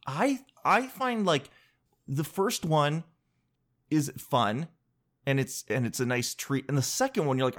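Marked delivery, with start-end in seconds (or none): none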